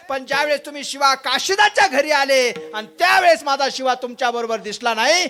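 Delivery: high, loud, sustained declamation, easing at the end; grieving mother's impassioned outcry. Acted. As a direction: pour out a mother's realisation to the king at full force. Voices bereaved mother addressing the king. (आर्त आईचा उंच, जोरदार आक्रोश)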